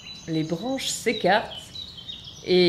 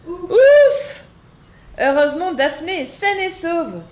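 high, loud relieved cry; relieved exclamation; 9 semitones higher, 7 dB louder than usual. Acted. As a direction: burst out with relief. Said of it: "s'exclament les papillons rassurés".

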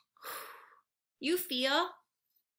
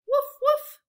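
high, loud playful woof; dog barking; 9 dB louder than usual. Shown as a demonstration. Teacher barking for the kids to copy. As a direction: bark like a happy puppy. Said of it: "make the same sound as the dog".

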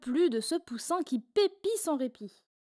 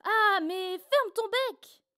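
shouting chorus; 8 semitones higher, much slower than usual. A narrator's reading. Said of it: "tous recommencent à crier".

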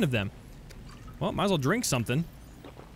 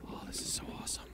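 very soft, voiceless whisper; awed whisper; 18 dB quieter, much faster than usual. Real, not acted. Player marvelling at the abandoned base, awed whisper. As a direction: whisper it in wonder.

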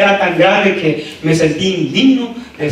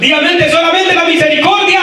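high call for the congregation to repeat; rallying preacher; 10 semitones higher than usual. Real, not acted. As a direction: lead the church in a raised-pitch declaration.

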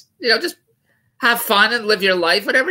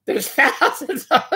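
cracking up; 11 semitones higher, slower than usual. Real, not acted. Cracks up reading the article.

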